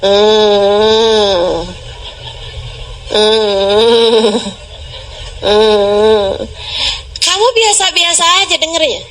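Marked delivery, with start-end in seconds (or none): 0.0-6.4 low, droning fake cry; half-hearted child's whine. Preacher imitating a child's unserious crying, low whine. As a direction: drone a lazy whimper on one low note. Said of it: "nangisnya kayak gitu tuh".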